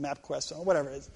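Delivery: normal talking voice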